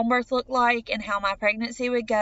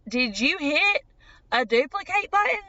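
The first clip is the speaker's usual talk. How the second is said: higher, incredulous retold question; wide-eyed disbelief; 5 semitones higher than usual. Patient asks doctor about the ultrasound image, wide-eyed disbelief. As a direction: climb in pitch as disbelief sets in. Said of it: "and then they grew wide".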